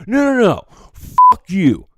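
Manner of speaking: loud, high-pitched angry outburst; acted furious refusal. Fed-up customer rejecting bartender's apology, furious. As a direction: cut him off and swear at full volume.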